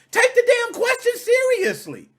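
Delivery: high, loud scolding; loud and fed up; indignant correction. Real, not acted. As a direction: demand they take it seriously. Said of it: "It's not".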